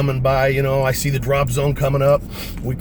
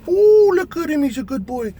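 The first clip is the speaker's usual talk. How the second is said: doting pet talk; 5 dB louder than usual. Real, not acted. Owner fussing over his yawning Mason, high cooing. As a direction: coo it at a beloved pet.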